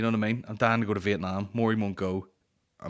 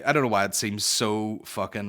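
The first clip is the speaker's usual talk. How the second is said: ordinary talk, nothing marked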